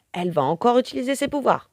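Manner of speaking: ordinary delivery, nothing marked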